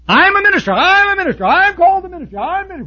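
high, loud, puffed-up self-important whine; mocking a boastful minister. Preacher mocks boastful ministers with a high, loud, self-important voice. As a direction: parody a preacher bragging about his title.

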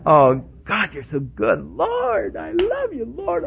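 soaring high ecstatic praise; praising the Lord. Retold or imitated. Pastor reenacting his joyful praise after the call, high and rapturous. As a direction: lift it up to heaven in rapture. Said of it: "Oh, praising the Lord".

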